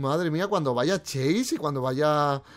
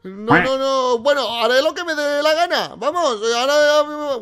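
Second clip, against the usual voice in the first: raised, high-pitched play defiance; indignant mock backtalk; defiant protest; 7 semitones higher, 7 dB louder than usual. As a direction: argue back loudly, pitch up. Argues back at Anger.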